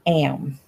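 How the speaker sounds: "am" drawn out slowly into two syllables. Retold or imitated. Teasing Lisa over her Southern accent, "am" in two syllables. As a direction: stretch "am" Southern-style into two beats. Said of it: "made two syllables out of I am".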